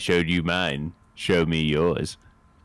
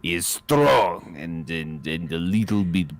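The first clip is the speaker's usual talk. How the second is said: Russian accent demo; slower than usual. Shown as a demonstration. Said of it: "The Russian is, is like this".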